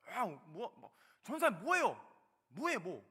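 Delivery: loud, high-pitched mock scolding; imitated angry yelling. Church member yelling at the young evangelist, angry imitated shout. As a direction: act out someone yelling at you. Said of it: "고래고래 소리를 지르시는 거예요".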